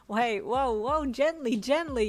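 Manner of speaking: loud, rising chiding protest. A narrator's reading. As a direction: scold him to slow down, loudly. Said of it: "you chided".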